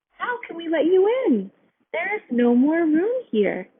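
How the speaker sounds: high, fretful voices; worried animals. A narrator's reading. Animals refuse mouse.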